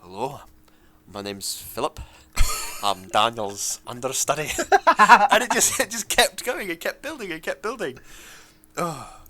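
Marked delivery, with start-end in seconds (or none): 0.0-4.5 1 Philip's voice. Retold or imitated. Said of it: "And he went".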